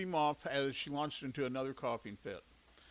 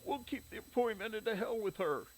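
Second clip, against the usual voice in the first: higher, tired mother; 7 semitones higher than usual. A narrator's reading. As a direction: voice the sick mother. Mother character voice in story.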